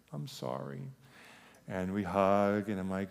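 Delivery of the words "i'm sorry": soft, higher imitation of a boy; child's apology. Son apologizing to his father, softly imitated remorse. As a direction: apologize quietly as a sorry child.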